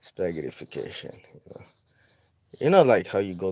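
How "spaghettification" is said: usual speaking voice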